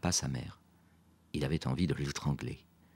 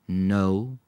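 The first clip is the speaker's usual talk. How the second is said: a drawn-out, louder single word; slowly; 4 semitones higher, 6 dB louder than usual. A narrator's reading. Quentin admits not knowing the film, slowly. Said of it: "he said slowly".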